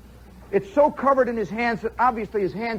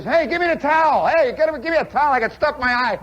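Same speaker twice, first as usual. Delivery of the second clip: loud, high holler for help; imitating a pestered man shouting; urgent calling out; 5 semitones higher, 4 dB louder, faster than usual. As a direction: yell impatiently over running water. Acted call for towel in shower.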